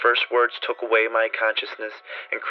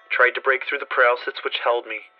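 plain reading voice

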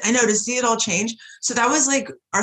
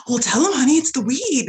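louder, higher, breezy retold retort; imitated dad; 6 semitones higher, 4 dB louder than usual. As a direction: call it out as a proud dad. Voicing her dad's proud answer.

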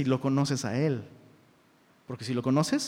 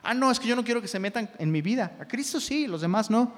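higher, defensive mock protest; mock excuse-maker; 5 semitones higher than usual. Pastor mimicking a member who wants nobody meddling, mock defensive. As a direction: imitate someone pushing others away, pitched up. Acts someone refusing accountability.

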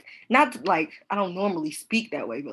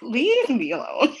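drawn-out, slightly raised protest; mock-exasperated retort; 5 semitones higher, 3 dB louder, slower than usual. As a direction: stretch it out like a teased person's plea. Retold retort to accent teasing.

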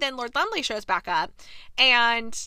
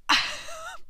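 squeaky high laugh. Real, not acted. Laughing at no point of reference.

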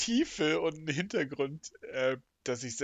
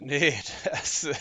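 normal talking voice

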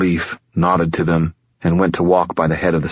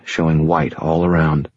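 ordinary delivery, nothing marked

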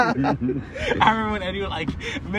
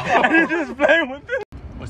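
laughing; 7 semitones higher, much slower than usual. Real, not acted. Laughing about friend's video.

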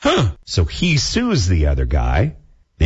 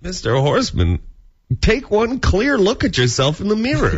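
stern judge; 11 semitones higher than usual. Pretend. Mock judge scolding client.

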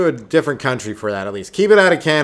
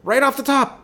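much higher, emphatic repeat; high incredulous repeat; 13 semitones higher, 4 dB louder than usual. Retold or imitated. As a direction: repeat it in high-pitched disbelief.

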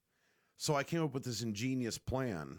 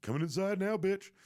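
taunting the dog; 8 semitones higher, 3 dB louder than usual. Retold or imitated. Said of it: "And then it's like".

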